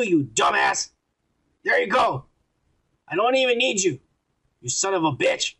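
high-pitched, indignant reading of a rant; mock angry rant. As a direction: act out an exasperated telling-off. Reads out a friend's angry rant.

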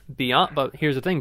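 normal talking voice

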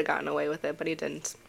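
unvoiced whisper. Real, not acted. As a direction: drop to a whisper. Trailing whisper on murder story.

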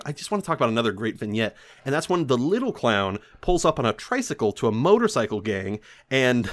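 ordinary talk

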